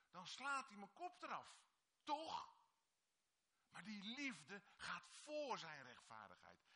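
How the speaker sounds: impassioned sermon, hammering each line; preaching at full force. Acted. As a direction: preach it out to the whole congregation.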